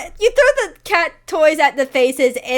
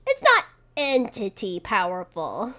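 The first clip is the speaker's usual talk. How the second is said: normal talking voice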